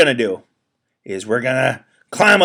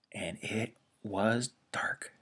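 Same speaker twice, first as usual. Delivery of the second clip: a soft, breathless hush; hushed whisper; 3 semitones lower, 16 dB quieter, slower than usual. A narrator's reading. Narrator whispering as darkness falls on earth. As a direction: whisper it into the dark.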